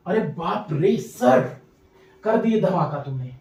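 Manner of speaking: low, soft, drawn-out put-on amazement; mock-awed student. Teacher playing a student stunned by the trick, hushed mock awe. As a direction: play the student marvelling slowly under the breath.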